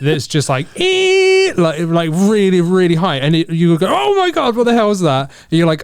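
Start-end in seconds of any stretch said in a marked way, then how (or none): 0.8-1.5 piercing electronic squeal, very high; high-pitched squeal. Retold or imitated. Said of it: "the most high-pitched squealing sound". 3.9-5.3 startled. Retold or imitated. Startled by squealing memory card.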